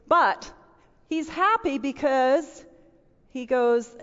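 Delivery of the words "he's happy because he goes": ordinary talk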